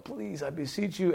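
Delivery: imitating a tearful plea; pleading. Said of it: "crying out. Sometimes he's going".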